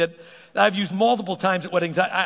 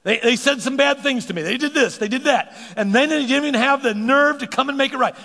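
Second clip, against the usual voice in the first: high, louder voice of a hurt person; aggrieved complainer; 7 semitones higher, 4 dB louder than usual. Retold or imitated. Preacher voicing a hurt listener's mild complaint. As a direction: list the wrongs in a wounded voice. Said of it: "Just list them".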